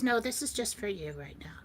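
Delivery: soft near-whisper; hushed confiding. Retold or imitated. He tells her it is just for her, hushed. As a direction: confide it very quietly. Retells his confiding reply.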